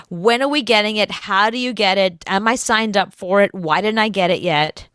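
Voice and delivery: ordinary talk, nothing marked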